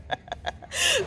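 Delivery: airy high giggle; breathy high laugh. Real, not acted. Vlogger laughing at Carol, airy high giggle. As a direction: let a silent laugh escape.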